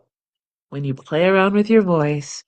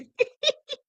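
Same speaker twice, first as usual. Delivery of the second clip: giggling; 6 dB quieter than usual. Real, not acted. Giddy laughter.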